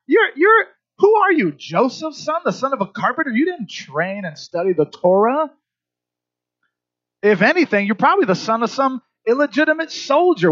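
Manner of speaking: high, scornful accusing rant; religious teachers scorning Jesus with a high, scornful rant; indignant teachers. Acted. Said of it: "religious teachers are like".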